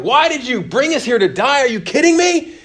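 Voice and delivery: high, whiny complaint, half-hammed; mock crying out. Israelites wailing at Moses by the sea, whiny mock outcry. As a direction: imitate frightened people wailing at Moses. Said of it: "they start crying out".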